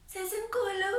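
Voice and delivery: high, sung-out name; mother's loving call. Retold or imitated. Mother waking her daughter with porridge, high and loving.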